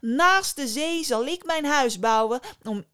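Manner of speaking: high, loud triumphant declaration; defiant fisherman. A narrator's reading. Fisherman rejects djinn. (luide, hoge vissersstem)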